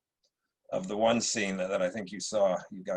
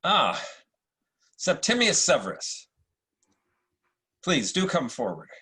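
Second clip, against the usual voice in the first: supercilious Marcus Aurelius; 5 semitones higher than usual. Acted. Emperor greets Septimius at court, lightly supercilious. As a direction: play it like a British actor's Roman emperor. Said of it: "a slightly supercilious Marcus Aurelius".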